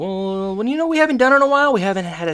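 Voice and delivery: high, strained, rushed stalling; uncomfortable. Retold or imitated. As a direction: imitate someone awkwardly dodging the question. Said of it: "like, uncomfortable".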